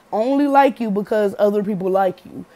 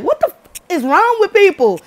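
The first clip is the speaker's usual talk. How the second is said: much higher, louder, incredulous; mock outrage; 9 semitones higher, 5 dB louder than usual. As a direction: sound shocked at how people act. Outraged reaction to sob story.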